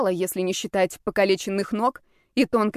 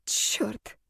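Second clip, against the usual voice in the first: whispered curse; 7 dB quieter than usual. A narrator's reading. Heroine curses under stress.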